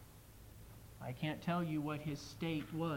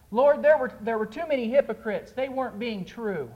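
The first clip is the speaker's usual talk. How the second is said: loud pleading excuse; 6 semitones higher, 10 dB louder than usual. Acted. Soul making excuses to Jesus.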